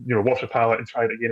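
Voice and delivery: normal talking voice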